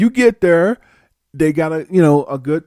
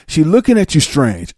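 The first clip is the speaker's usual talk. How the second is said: ordinary talk, nothing marked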